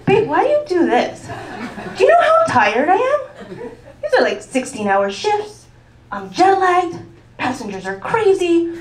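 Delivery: mock self-pity, laughing through it; hammed-up tired wife's complaint; whiny complaint. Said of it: "I'm like".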